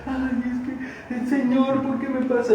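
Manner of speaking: high, drawn-out soft whine; mock whining. Preacher mimics believers questioning God over a flu, mock whining. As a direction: whimper it in a put-on plaintive voice.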